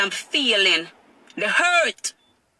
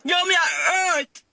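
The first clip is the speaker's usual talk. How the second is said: voice breaking; 5 semitones higher, slower than usual. Real, not acted. Mother breaking down over custody.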